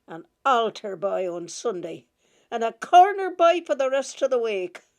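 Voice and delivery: loud, contemptuous rebuke; scornful scolding. A narrator's reading. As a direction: shame them with a loud sneer. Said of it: "as she upbraided us".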